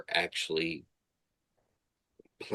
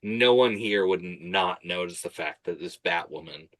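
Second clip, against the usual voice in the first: ordinary talk, nothing marked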